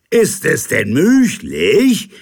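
high, loud, slow disbelief; awed captain. Acted. Captain sees the figure, high and awed. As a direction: barely dare to hope.

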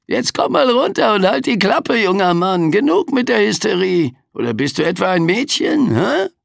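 high, gruff, sarcastic Reaper voice; cranky Death telling him off; grumpy Grim Reaper. A narrator's reading. Death scolds the boy. (mürrischer Sensenmann)

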